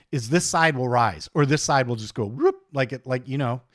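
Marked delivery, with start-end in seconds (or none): 2.3-2.5 quick rising whoop sound effect; imitated whoop. Shown as a demonstration. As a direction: make the noise of something popping up. Imitating screen edge lifting.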